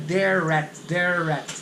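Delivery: they're at run together, R linked into at. Shown as a demonstration. Teacher to students, linking they're at smoothly. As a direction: join the R of "they're" straight onto "at". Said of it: "Se liga o R no at".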